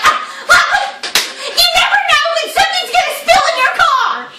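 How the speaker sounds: very high, loud, rushed defense; mock frantic shriek. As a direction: imitate a panicking friend shrilly justifying herself. Friend frantic over her napkins.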